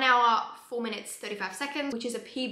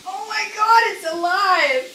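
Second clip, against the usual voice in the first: loud, high burst of amazement; real thrilled outburst; excited exclamation; 9 semitones higher, 12 dB louder than usual. Real, not acted. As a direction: cry out as if it just came to life. Mattress starts expanding by itself.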